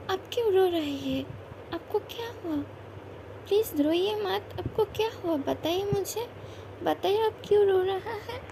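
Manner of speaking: anxious, high pleading questions; worried pleading. Acted. Wife begging her crying husband to explain, worried and pleading. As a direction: beg him to say what's wrong.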